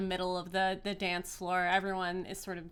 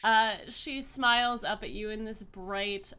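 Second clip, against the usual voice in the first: ordinary talk, nothing marked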